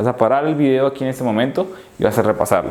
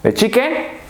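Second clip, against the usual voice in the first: ordinary delivery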